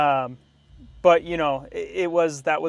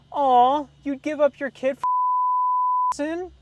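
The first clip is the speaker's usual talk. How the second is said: much higher, slow, syrupy mock-pity voice; mocking loan officer; 13 semitones higher, 4 dB louder, slower than usual. Loan officer teasing the sad seller, high mock-sweet coo. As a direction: coo it like a teasing lady rubbing it in. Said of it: "and she goes".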